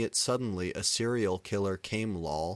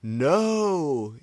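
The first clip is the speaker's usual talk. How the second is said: high, loud drawn-out no; anguished scream; 8 semitones higher, 9 dB louder than usual. A narrator's reading. Everyone screaming at the bullet, loud drawn-out no. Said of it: "yielded everyone".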